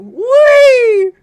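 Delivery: long, high, loud "whee"; playful whee. Pretend. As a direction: squeal it like riding a slide. Spinning the bingo winner around.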